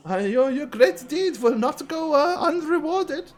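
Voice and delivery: high, soft, fawning cleric voice; high priest voice. Acted.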